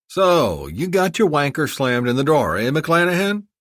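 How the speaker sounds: high, loud mocking jibe; teasing jab. A narrator's reading. Defense Secretary teasing McClanahan as he sits down, loud mocking jibe. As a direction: needle him with a grin.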